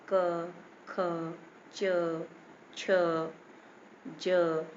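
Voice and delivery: each of क, ख, च, छ, ज said alone with a short "a"; short inherent vowel. Shown as a demonstration. Teacher showing children the inherent vowel, separate letter sounds. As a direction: sound out क, ख, च, छ, ज one by one. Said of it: "अ का उच्चारण हो रहा है".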